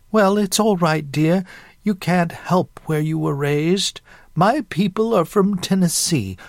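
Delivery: put-on high Southern old-lady voice; chatty Southern great-aunt. Great-aunt telling newcomer about her Southern roots, chatty Southern lady. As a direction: gush proudly as a Southern matron.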